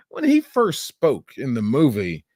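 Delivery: usual speaking voice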